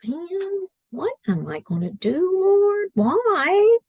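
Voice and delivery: high, pleading cry of Oh man and Why; pleading her past self's despair to God. Retold or imitated. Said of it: "And I'm like".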